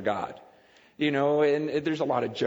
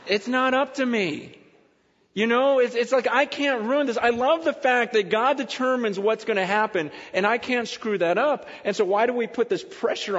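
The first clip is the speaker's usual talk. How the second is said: excited preaching; 7 semitones higher, 4 dB louder than usual. Real, not acted.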